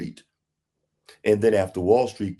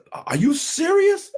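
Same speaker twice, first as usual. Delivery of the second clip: much higher, slow, squealing disbelief; astonished. Retold or imitated. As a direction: voice jumps up in amazement. Narrator retells own astonishment.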